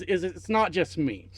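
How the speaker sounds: ordinary talk, nothing marked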